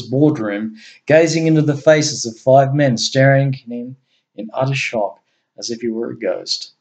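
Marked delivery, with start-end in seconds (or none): none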